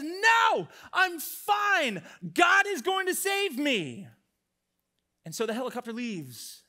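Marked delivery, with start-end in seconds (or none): 0.0-4.1 hammed-up high shout; loud, stubborn mock yell; shouted refusal. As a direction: holler it at the rescuer, stretching the last words. Man pushes rescuer away, shouting.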